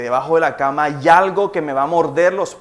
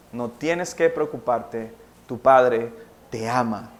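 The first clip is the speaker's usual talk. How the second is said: tender reassurance; 7 semitones lower, 4 dB quieter, slower than usual. Acted. Christ's tender words to listener.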